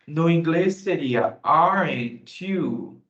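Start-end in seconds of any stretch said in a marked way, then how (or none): 1.4-3.0 very slow confirming tag; slow tag question. Shown as a demonstration. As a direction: drag out the tag to check. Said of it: "eu quero confirmar". (pergunta de confirmação bem lenta)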